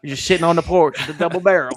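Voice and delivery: hammed-up country voice; mock Southern voice. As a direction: tease like a hillbilly on a porch.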